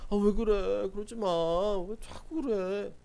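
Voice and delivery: slow, coaxing 왜 자꾸 그래; mock placating mother, drawn out; pleading soothing mom. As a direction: mimic a mom begging her child to stop. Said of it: "이러면 애 베리는 거예요".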